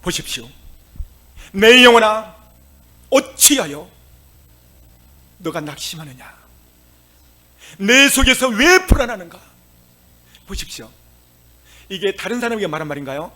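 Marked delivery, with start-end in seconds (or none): 1.5-9.4 loud, high call to his own soul; rousing self-address. Acted. Preacher as David rebuking his own soul, loud. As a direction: rouse your own soul. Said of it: "자기 영혼에게 말하는 거예요".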